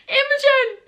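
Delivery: high squeaky shocked calling of her name; mock shocked boyfriend. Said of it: "he was like".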